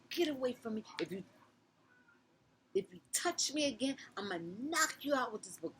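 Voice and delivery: screamed high and loud, raging; furious threat. Retold or imitated. To the girls, threatening with a book, a high furious scream. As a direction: threaten them at the top of your voice.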